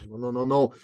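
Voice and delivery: ordinary talk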